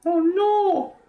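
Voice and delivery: high, startled oh no; half-joking gasp of alarm at the news; mock alarm. Real, not acted. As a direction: play up the shock, pitch jumping way up. Reacting to Nahida shrinking.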